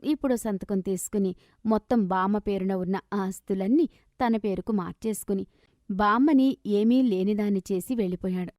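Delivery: ordinary delivery, nothing marked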